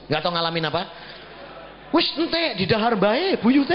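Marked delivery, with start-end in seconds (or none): 1.9-3.7 put-on old Javanese father-in-law, pitched higher. Father-in-law talking to the ancestors, higher imitated voice. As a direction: lightly put on an elderly villager's voice.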